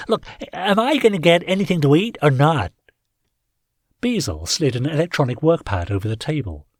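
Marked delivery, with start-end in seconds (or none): 0.1-2.7 impatient landlord voice. A narrator's reading. Tinkler impatient.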